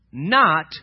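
usual speaking voice

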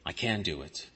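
whispering the same boast to himself; fading self-assurance. Acted. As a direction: let the boast sink to a murmur. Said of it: "And they say".

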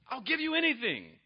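high, desperate promise; hammed-up desperation; overdramatic pleading. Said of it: "he overreacts in his passions".

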